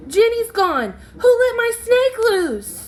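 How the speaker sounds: high, loud panicked cry; shouting. Acted. Emily shouts that her snake is loose, high and panicked. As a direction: shriek it like your pet snake just escaped. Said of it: "Emily shouts".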